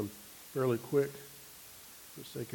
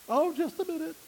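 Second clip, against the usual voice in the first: much higher mock girl's voice; dorm girl on intercom; 3 dB louder than usual. Preacher voicing a dorm resident answering the buzzer, high girlish voice. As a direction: chirp it up high like a college girl.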